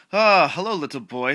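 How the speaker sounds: loud, high, hammed-up hello; creepy clown greeting. Clown greets the lost boy, loud hammed-up greeting. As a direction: play an over-eager creepy clown.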